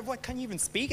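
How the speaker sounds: mildly exasperated, under the breath; frustrated retelling. Retold or imitated. Preacher recalling a muddled chat with Grace, frustrated. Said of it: "I'm getting frustrated at her".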